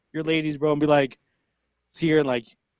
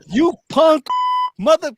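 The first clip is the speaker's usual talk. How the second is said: high, loud furious yell; angry wife yelling; 11 semitones higher, 8 dB louder than usual. Acted. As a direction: yell it at him in fury. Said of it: "His wife's like".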